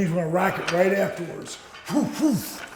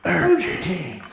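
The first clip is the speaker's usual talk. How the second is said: ordinary talk, nothing marked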